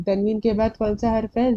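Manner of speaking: ordinary delivery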